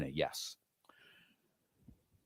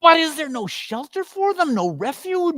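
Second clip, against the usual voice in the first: horrified plea; 14 semitones higher than usual. Acted. Scrooge begs the Spirit about the wretched children, high and alarmed. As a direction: cry out in horror at the children.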